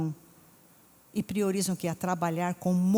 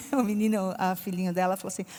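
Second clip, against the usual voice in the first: normal talking voice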